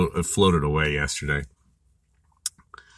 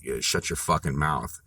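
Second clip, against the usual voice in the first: softer, lower menacing "shut your fucking mouth"; quiet, lowered threat; 4 semitones lower, 7 dB quieter than usual. Retold or imitated. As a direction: say it low and cold. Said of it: "I said it just like that".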